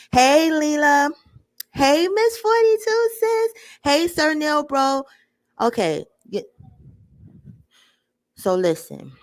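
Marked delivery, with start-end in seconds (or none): 0.1-5.0 excited greeting. Real, not acted. Greeting chat viewers by name.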